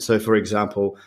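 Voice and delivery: ordinary talk, nothing marked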